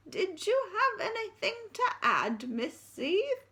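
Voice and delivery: put-on high, quavering old lady asks; old headmistress inquiring. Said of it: "inquired Miss Stint".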